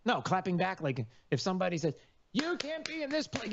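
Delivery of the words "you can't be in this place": high, thin mocking voice of a scold; a high mocking voice scolding the neighbour. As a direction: sneer it like a self-righteous scold.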